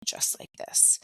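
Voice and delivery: hushed, dropping to a near whisper; quiet, toneless talk. Shown as a demonstration. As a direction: let it sink to a low, breathy murmur. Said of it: "or if my tone".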